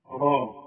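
غا said the wrong way, with a thin flat a; wrong open ghain. Shown as a demonstration. To Quran students, showing the wrong غا sound. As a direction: demo of the mistake: غا with a light, unrounded a. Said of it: "اوعى تقول غا أبدًا".

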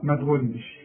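ordinary delivery

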